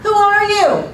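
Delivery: high, loud call returning as an echo; echoed yell. Acted. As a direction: copy the boy's yell back as the mountain. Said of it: "A voice came back".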